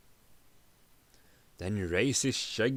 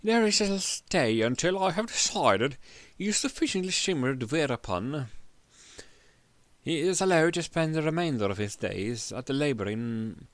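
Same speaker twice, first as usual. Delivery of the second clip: raised, gloating royal decree; king's decree; 5 semitones higher than usual. Acted. King sentencing the prisoner, a high gloating decree. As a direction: savor the cruel sentence.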